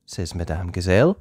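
usual speaking voice

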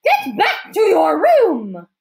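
loud, high, drawn-out command; irate shouted order; angry order. A narrator's reading. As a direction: send them away with a slow, forceful shout. Angry woman ordering someone away.

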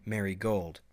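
plain reading voice

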